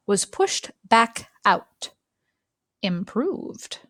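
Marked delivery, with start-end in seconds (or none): none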